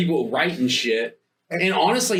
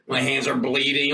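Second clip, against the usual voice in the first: ordinary talk, nothing marked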